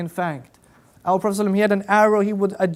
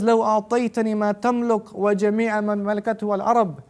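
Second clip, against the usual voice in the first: rapid Arabic quote; much faster than usual. Real, not acted. Quoting the Arabic narration fast.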